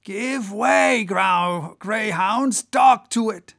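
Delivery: high, forceful urging in place of a whisper; intense urging. Acted. Boat officer to his rowers chasing the whale, intense urging. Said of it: "in his old intense whisper".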